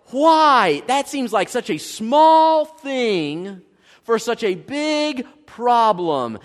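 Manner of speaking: slow exasperated complaint; mock frustrated protest. Preacher voicing a frustrated believer, slow and mildly exasperated. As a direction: drag it out in disbelief. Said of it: "you just kinda get frustrated".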